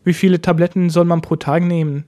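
ordinary talk

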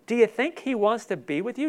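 normal talking voice

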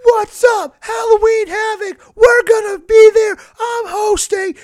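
very high, loud mock-hype screech; Shotzi. Podcaster mocking a host who talks to camera, screeching hype. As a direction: imitate an over-the-top hype girl shouting at the camera. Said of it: "She's just like".

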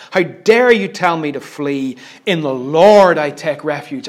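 forceful, indignant declaration; angry yelling. Acted. David answers those telling him to flee, angrily. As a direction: throw it back in defiance. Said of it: "He is yelling this".

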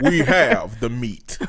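low, slow announcer boom; imitating a deep, powerful ad voice; powerful commercial voice-over. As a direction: drop low and stretch the slogan.

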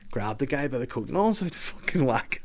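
usual speaking voice

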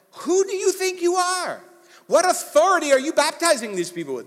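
high, loud indignant challenge. Retold or imitated. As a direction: demand it with outrage.

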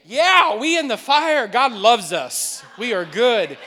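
loud, triumphant declaration; loud, drawn-out rejoicing; exuberant faith. Acted. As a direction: shout it like good news. Acts defiant joy amid trouble.

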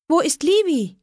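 high, sudden worried cry; girl missing her favourite kitten, alarmed; alarmed call. A narrator's reading. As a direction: call out in a flash of panic. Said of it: "rief ich".

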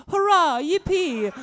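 mock cheer. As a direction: whoop with silly glee at the sheep. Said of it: "I just see some wet sheep and go".

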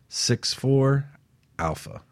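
ordinary talk, nothing marked

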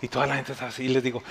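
ordinary delivery

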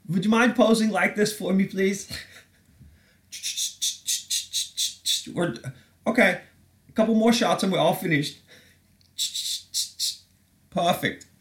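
high-pitched, put-on photographer voice; high mock photographer patter. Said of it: "Whatever photographers say".

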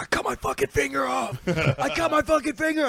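high frantic mock yell; dad faking an injury. Dad pranking coworkers, high fake panic. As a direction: play the panicked dad with the cut finger. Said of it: "run around and be like".

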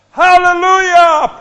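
a huge, high, drawn-out shout of praise; shouted hallelujah. Shown as a demonstration. Preacher showing the congregation how to shout, a huge drawn-out cry. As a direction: throw the whole voice into a long Hallelujah. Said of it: "And you should shout".

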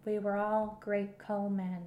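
ordinary talk, nothing marked

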